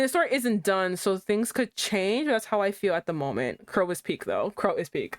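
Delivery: normal talking voice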